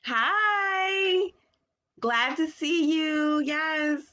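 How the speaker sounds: bright high greeting voice; high sing-song greeting. Acted.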